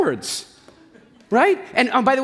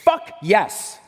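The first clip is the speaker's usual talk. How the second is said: demo cheer. Shown as a demonstration. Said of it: "what you're gonna do is say".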